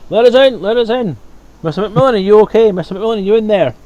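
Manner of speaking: loud, high calls through a door; guards shouting. Acted. Guards outside shouting to their boss, loud and urgent. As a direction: bang on the door and yell for him.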